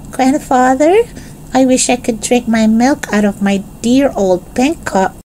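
put-on high-pitched small child voice; little Benny, wistful.

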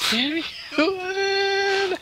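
high whiny mock sob; mock wail. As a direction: wail it like a fake tantrum. Mock crying complaint.

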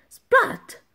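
very high, comic splat of a snowball; splat sound. A narrator's reading. Narrator voicing a snowball hitting Elsa, comic splat. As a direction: pop the impact noise.

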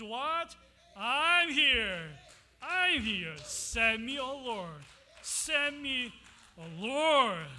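loud, urgent calling out of send me; eager answer. Acted. Isaiah answering the Lord's call, loud and eager. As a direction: offer yourself with full force.

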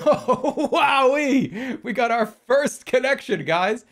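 loud, high, over-the-top celebration; hammed-up glee, drawing out the words; mock excitement. Mockingly celebrates a trivial first connection.

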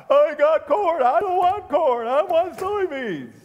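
high, loud, hammed-up bawling; mock crying. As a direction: mimic a whining, wailing farmer. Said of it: "Don't stand there crying".

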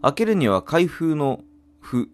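ordinary talk

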